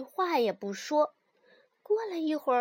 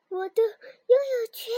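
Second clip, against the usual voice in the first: panicked cry; 8 semitones higher, 5 dB louder than usual. Acted. Frog panics in water.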